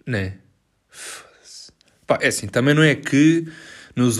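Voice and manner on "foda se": breathy whispered swear under breath; whispered curse. Real, not acted. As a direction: curse quietly to yourself. Muttered curse at own mistake.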